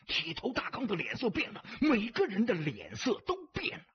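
ordinary delivery, nothing marked

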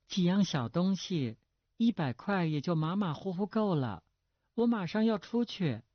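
put-on high-pitched girlish wheedling; a pampered girl pressing for money; coquettish daughter. A narrator's reading. Daughter asking her father for a hundred, coquettish and high. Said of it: "女郎又说".

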